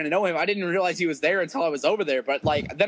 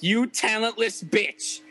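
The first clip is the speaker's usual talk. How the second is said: higher, slow drawn-out taunt; mock insult; 4 semitones higher, slower than usual. Host retells greeting his friend Dwayne, slow mock taunt. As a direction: stretch out the insult at a raised pitch.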